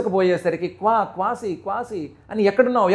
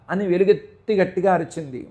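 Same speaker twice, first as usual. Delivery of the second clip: usual speaking voice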